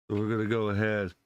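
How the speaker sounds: normal talking voice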